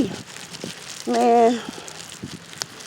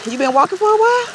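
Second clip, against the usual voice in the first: sweet high asking voice; 11 semitones higher than usual. Retold or imitated. Sweetly asking lost old man.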